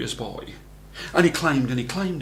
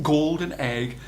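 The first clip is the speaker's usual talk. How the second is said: ordinary delivery, nothing marked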